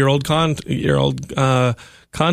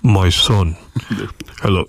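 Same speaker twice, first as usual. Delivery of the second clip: lower, booming God voice; a grand, solemn proclamation; 3 semitones lower than usual. Shown as a demonstration. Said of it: "That sounds horrible".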